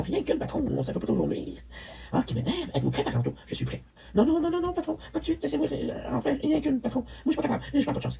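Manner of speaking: high, soft whining plea; whiny pleading. Acted. Ernest begging the boss for one incantation, high and whiny. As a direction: beg like a nervous little sidekick.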